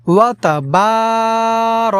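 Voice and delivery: long drawn-out ওয়া তাবার, vowel held; drawn-out Quranic recitation; elongated vowel. Shown as a demonstration. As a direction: stretch the আ in তাবার slowly. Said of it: "এখানে আমরা টেনে পড়ব".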